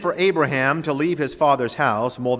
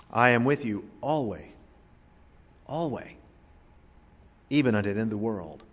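"always" set apart and softly repeated; quiet, deliberate weight on "always"; stress on ALWAYS; 4 dB quieter than usual. Shown as a demonstration. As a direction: land on "always", pause, say it over quietly. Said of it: "Emphasize a different word".

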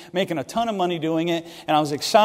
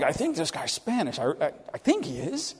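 unsure mutter; 7 dB quieter than usual. Retold or imitated. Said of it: "He was kinda like".

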